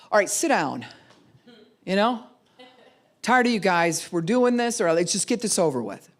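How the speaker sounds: impatient parent. Retold or imitated. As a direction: get it over with, annoyed. Impatient parent calls family meeting.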